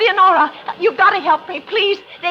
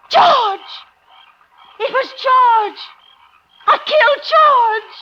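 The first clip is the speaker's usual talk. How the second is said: horrified realization; 4 semitones higher, much slower than usual. Acted. Woman realizes she shot her own husband, horrified cries. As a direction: cry out in horror as it dawns.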